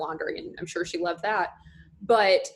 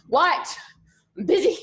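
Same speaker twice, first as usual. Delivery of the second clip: higher, louder, mock-annoyed retort; exasperated reply; 8 semitones higher, 5 dB louder, slower than usual. Daughter interrupted by dad, acted exasperation. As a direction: snap back like an interrupted kid.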